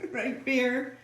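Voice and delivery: high sing-song voice to the cat; talking to a pet. Real, not acted.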